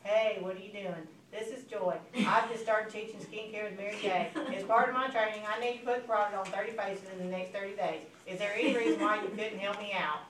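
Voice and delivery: dull, even-pitched sales script; monotone. Shown as a demonstration. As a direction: recite it without any enthusiasm. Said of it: "when you call in monotone".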